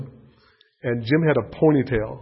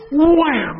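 a high, loud "wham" for the drive; imitated club whack; 13 semitones higher, 7 dB louder than usual. Telling the congregation about Jim's drive, a high loud wham. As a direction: punch out the crack of the club on the ball.

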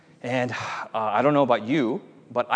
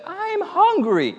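put-on very high, drawn-out child's whine; squeaky-high little boy complaint; whining little brother; much slower than usual. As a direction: whine it like a hungry little kid. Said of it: "the little brother said".

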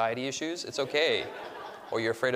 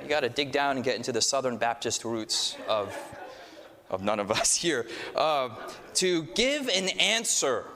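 ordinary talk, nothing marked

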